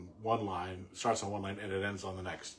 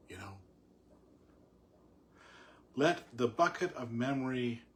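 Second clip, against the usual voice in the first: poem reading; 3 semitones higher, 3 dB louder than usual. Acted. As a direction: let each line settle before the next. Reads the poem aloud.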